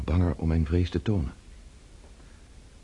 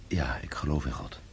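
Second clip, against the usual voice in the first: softer, whispered "Ja, ik geloof in God" as a private vow; whispered affirmation; 5 semitones lower, 6 dB quieter than usual. Acted. Pierre answering the mason, whispering his faith to himself. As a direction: breathe the words out as a whisper.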